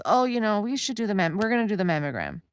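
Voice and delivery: a light mock of a bright casual nurse; nurse's breezy voice. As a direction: play the falsely cheerful technician.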